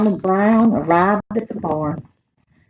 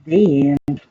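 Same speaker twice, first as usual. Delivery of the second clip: put-on reading to children; 2 semitones higher than usual. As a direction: read it like a picture book to a class of children. Librarian reads picture book to kids.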